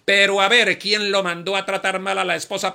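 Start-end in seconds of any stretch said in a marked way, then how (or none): none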